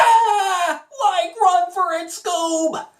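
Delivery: high, slow, cracking Shaggy shout. Shown as a demonstration. Demo for viewers, high panicky Shaggy yelling to Scooby. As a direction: pitch way up and stretch it like a scared slacker. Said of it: "this version of Shaggy".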